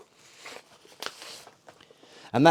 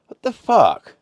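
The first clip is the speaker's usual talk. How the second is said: sudden high, loud what the fuck; surprised outburst; 12 semitones higher, 11 dB louder, slower than usual. Real, not acted. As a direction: blurt it out in disbelief. Reacting to the item.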